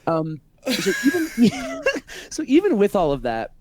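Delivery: laughing. Real, not acted. Laughing after embarrassing story.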